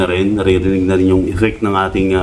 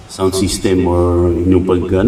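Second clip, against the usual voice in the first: ordinary talk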